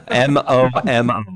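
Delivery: M O M O spelled letter by letter, slow; slow letter-by-letter spelling of M O M O. Shown as a demonstration. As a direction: spell out M O M O slowly, each letter distinct. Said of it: "M, double M".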